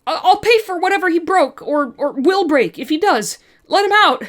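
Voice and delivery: loud, high, pleading yell; shouting at the shopkeeper to free Bob; desperate shout. Acted. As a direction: beg him to let your friend out.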